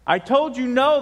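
high, loud telling-off; acted mom losing patience; scolding mother. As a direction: scold a pestering child. Mother scolds son in joke.

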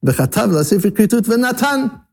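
high, level run up to a final "v'natan"; phrase closed after v'natan. Shown as a demonstration. Teacher showing the new reading, high phrase stopping at "v'natan". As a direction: say it as one phrase ending on "v'natan". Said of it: "So that allows you to say it".

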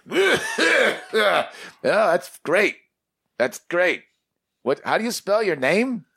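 put-on high old creator voice. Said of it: "He's like".

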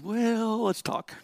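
high-pitched, stalling "well"; mock-casual dad. Dad answering his young son, mock-casual stalling. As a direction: lift it high, like a dad buying time.

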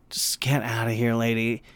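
voiceless whispered aside; a whispered plea for the customer to leave; under-breath whisper. Retold or imitated. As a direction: whisper it so she can't hear.

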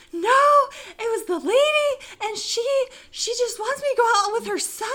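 high-pitched frantic protest, retold; panicked. Explaining to friend that the lady wants a date for her son, panicked. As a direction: blurt the news out in mock panic. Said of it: "call Becky in a panic".

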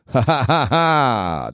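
slow, deliberate "ha ha ha ha" belly laugh; better laugh. Shown as a demonstration. Comic showing off his better laugh, drawn out and deliberate. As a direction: draw out a demonstration laugh. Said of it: "That's a better laugh".

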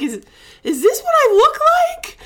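high, loud wail of dismay; acted-out tearful outburst; retold crying. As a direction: wail it in horrified disbelief.

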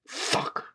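soft, voiceless arrow-hit sound effect; imitated arrow thwack. A narrator's reading. Narrator mimics the arrow hitting Jake, whispered sound effect. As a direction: make a hushed thwack like an arrow striking.

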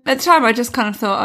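usual speaking voice

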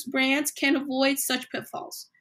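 ordinary delivery

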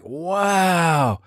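put-on high, awed little boy; childlike wow. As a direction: gasp it out like a kid amazed by his dad. Boy amazed by his dad.

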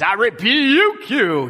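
slow, loud mock Southern Baptist preacher rebuke; a hint of a preacher's rebuke. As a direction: ham up the old Baptist pulpit rebuke. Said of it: "our old Southern Baptist days".

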